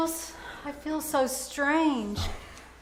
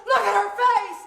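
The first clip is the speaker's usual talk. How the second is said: high, loud panicked cry of alarm; 7 semitones higher, 4 dB louder than usual. Acted. Student to professor, seeing a sick classmate, panicked alarm. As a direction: scream it out as the horror hits you.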